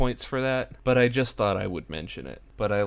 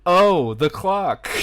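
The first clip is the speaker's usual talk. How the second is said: much higher, louder, slow startled Niles; 9 semitones higher, 9 dB louder, slower than usual. Retold or imitated. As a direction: let Niles squeak it out, caught off guard. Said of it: "and then goes".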